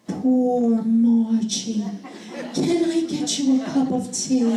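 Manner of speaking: wife showing husband how to comfort her, tender and slow; tender sympathy. Retold or imitated. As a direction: coo it like comforting a child. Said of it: "I want you to say".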